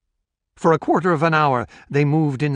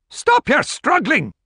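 high, slow holler; 13 semitones higher, 4 dB louder than usual. A narrator's reading. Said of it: "Grant hollered at the handler".

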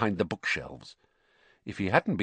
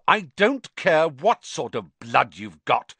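slow, higher, emphatic anger; fierce; 5 semitones higher, 4 dB louder, slower than usual. A narrator's reading. Harry cutting off Ernie, fierce. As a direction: cut him off and hammer it out. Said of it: "said Harry fiercely".

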